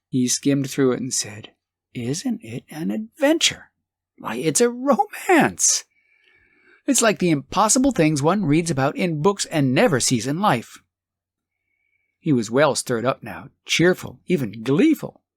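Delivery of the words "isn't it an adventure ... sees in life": high, wondering delight; a husband dreaming of a fortune, delighted; thrilled. Acted. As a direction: marvel at the romance of it.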